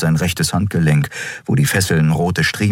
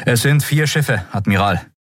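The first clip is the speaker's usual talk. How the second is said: quick, higher-pitched bridge report; officer's report; 8 semitones higher than usual. A narrator's reading. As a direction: report urgently to the admiral. Officer reports to admiral under threat. (zügige, hohe Meldung)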